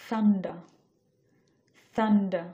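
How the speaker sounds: "thunder" with no final r, said loud and clear; British thunder. Shown as a demonstration. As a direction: drop the r at the end of "thunder". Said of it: "লাস্টের র সাউন্ডটা pronounce করতেছি না". ("thunder" শেষের র ছাড়া British উচ্চারণে)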